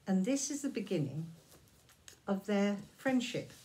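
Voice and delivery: usual speaking voice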